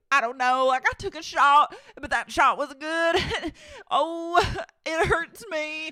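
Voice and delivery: high, loud whining complaint; hammed-up sick, self-pitying wail; Brittany. Wife whines to husband after a shot, mock pitiful. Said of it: "And she's like".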